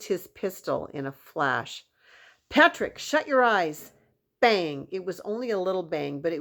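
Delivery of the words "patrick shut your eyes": high, loud urgent yell; shouted warning. Acted. Cowboy yelling at Patrick to shut his eyes. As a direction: bark the warning before firing.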